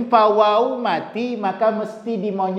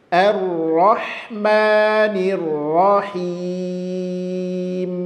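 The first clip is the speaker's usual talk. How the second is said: slow, sustained Quran recitation; tilawah tartil; much slower than usual. Shown as a demonstration. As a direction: recite with long held vowels and rolled r. (bacaan tartil, pelan dan panjang)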